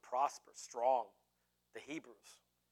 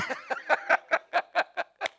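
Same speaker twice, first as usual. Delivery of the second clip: long, high, loud cackle; laughter; 13 semitones higher, 5 dB louder than usual. Real, not acted. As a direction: keep cackling at your own joke. Laughs at own joke.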